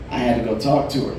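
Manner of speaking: normal talking voice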